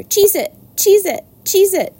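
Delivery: very high, loud squawked alarm; shouted warning. A narrator's reading. Gander tells the animals to scatter, high shouting. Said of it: "shouted the gander".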